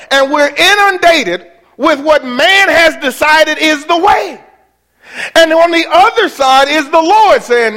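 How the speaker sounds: high, loud, driving preacher voice; preaching at full force. Acted. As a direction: build and thunder it to the congregation.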